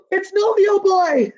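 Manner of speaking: high, slow shout of discovery; surprised shout. Acted. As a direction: cry out the name in amazement. Animals shout on seeing him.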